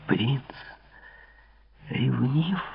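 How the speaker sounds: soft, charming special tone; very quietly. A narrator's reading. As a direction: say it gently under your voice, with long pauses. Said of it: "тихонько проговорила она особенным тоном". (тихонько, особенным тоном)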